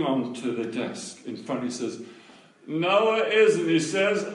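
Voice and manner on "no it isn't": high, loud, slow contradiction; firmly insist, voice raised; insistent denial. Retold or imitated. Little boy answering the heckler, imitated loud insistence.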